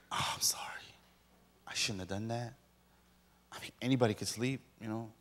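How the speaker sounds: soft, low, sheepish pleading; mock remorseful apology. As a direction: act contrite, hushed and halting. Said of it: "then I feel bad about it".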